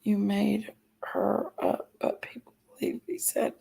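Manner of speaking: breathy reading of chat in a whisper. Acted. Streamer whispering a chat message to viewers, whispering. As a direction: hush it right down, no voice.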